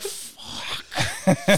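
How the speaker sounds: drawn-out whispered curse; whispered dread. Retold or imitated. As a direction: curse under your breath, sinking realization. Dreading being caught by cop.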